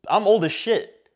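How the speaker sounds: normal talking voice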